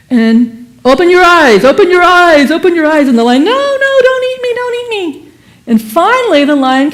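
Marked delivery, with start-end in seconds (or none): 0.9-3.1 louder, higher lion voice calling the cub; urging lion. Acted. 3.4-5.2 much higher, pleading lion cub voice; terrified lion cub. Acted. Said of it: "piteously crying".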